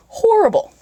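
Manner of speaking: high hammed-up disgusted wail; exaggerated disgust. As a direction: squeal it in mock disgust. Exaggerated repeat of horrible.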